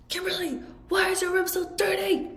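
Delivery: high mock yelling dad; exasperated dad. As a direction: play the fed-up dad calling his daughter. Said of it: "He's like".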